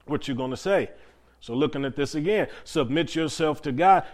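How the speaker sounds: ordinary talk, nothing marked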